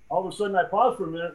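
ordinary delivery

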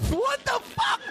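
high, drawn-out cry of disbelief; high-pitched exasperated yelp; incredulous outburst. Real, not acted. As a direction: burst out in laughing disbelief. Outburst at a read-out word.

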